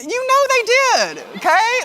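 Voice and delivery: very high, drawn-out squeal of certainty; knowing exclamation. Acted. Speaker to parents about fibbing kids, high and knowing. Teasing about kids' denial.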